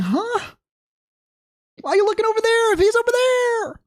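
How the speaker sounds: very high, strained squeal; mock squealed disbelief. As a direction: squeal it in exaggerated bafflement. Retells exasperated reaction to someone looking away.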